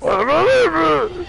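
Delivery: high, drawn-out imitation of the game's god; mock booming god. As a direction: stretch it out like a mighty god echoing the game.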